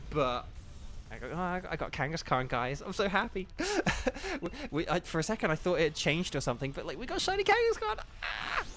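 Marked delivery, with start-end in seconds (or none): 3.5-4.4 high, delighted giggle; happy giggle. Real, not acted. Just caught a shiny. 7.0-8.6 high, gleeful squeal; excited. Real, not acted. Celebrating a shiny catch.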